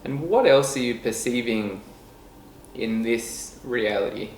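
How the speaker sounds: calm meditation guide with long, unhurried pauses; guided meditation. Real, not acted. Leading listeners to notice breath and body, guided meditation voice. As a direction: ease them softly into stillness, letting phrases trail down. We're gonna go into a bit of a meditation right now.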